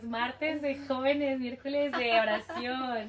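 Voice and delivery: slow, drawn-out list; dragging, singsong enumeration; weary listing. Real, not acted. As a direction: stretch out each item of the endless schedule. Listing endless weekly church services.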